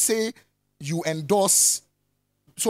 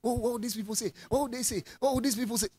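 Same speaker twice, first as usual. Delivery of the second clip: mocking the anxious what-will-people-say chorus; mock fretting; 6 semitones higher, faster than usual. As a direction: fret in a high little voice. Mimics people fretting over others' opinions.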